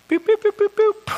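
short, high, even phone beeps; imitated dialling beeps. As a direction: beep like a number being dialled.

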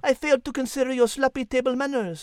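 put-on light French accent for a cat; a polite French feline, lightly accented. Cat Liz speaks.

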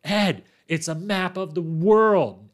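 mock yell. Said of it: "And Devin yells out".

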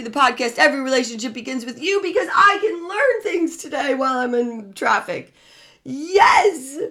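high-pitched, gleeful pep talk to oneself; overjoyed to be stuck in traffic, building to a triumphant cheer; exuberant gratitude. Acted. Models a grateful reaction to traffic.

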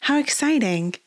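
bright, high little exclamation; a lady thrilled by travel tales; excited. A narrator's reading. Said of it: "sapphire eyes sparkled".

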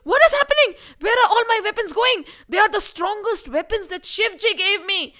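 high, fast baffled cry; bewildered villain. Acted. Vishwarath seeing his weapons vanish, high bewildered cry. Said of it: "Vishwarath is saying".

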